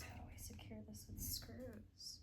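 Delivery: whispered questions to herself, quickening. Real, not acted. Whispering to herself, puzzled over where the screws go.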